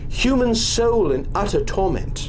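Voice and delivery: put-on young woman telling of horror. Sybil describing the wailing noise to the doctor, ominous. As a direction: make the doctor feel the dread. She describes the haunting sound.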